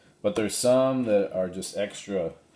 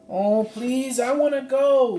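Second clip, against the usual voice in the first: put-on much higher, louder pleading girl's voice; Annie begging; 6 dB louder than usual. Annie begging Slim to take her along, high pleading. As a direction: plead like an eager little girl.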